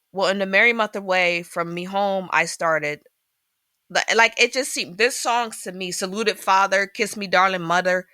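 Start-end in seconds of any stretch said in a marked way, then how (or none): 5.9-8.1 lightly put-on Irish accent, reciting; mild Irish lilt on the lyrics. Shown as a demonstration. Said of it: "saying this with an accent".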